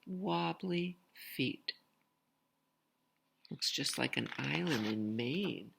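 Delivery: usual speaking voice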